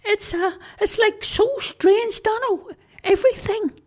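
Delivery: high cartoon creature voice; squeaky Charlie voice. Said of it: "Charlie nodded his head".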